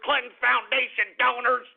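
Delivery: usual speaking voice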